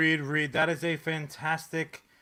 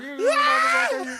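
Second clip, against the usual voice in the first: hammed-up psycho shriek; mock axe-murderer scream. Reed's doppelganger attacks with axe.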